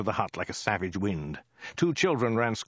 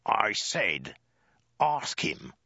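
menacing, emphatic soldier; deliberate, threatening hiss; hissed order; much slower than usual. A narrator's reading. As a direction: force each word out as a threat. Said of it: "he hissed".

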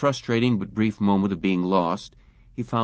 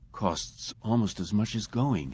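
softer, slower inward voice; inner thought; 5 dB quieter than usual. A narrator's reading. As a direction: think it to yourself. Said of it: "he thought".

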